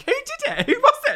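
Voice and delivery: high, loud, laughing accusation; hammed-up high-pitched demand; mock accusation. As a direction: call out loudly as if blaming others. Acting out culprit deflecting blame.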